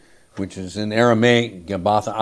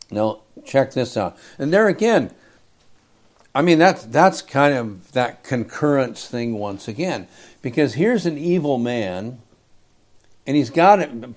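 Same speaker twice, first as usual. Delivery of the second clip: usual speaking voice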